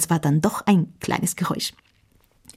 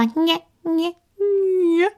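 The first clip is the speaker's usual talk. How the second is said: squeak; 12 semitones higher, much slower than usual. Acted. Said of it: "hörte auf zu quietschen".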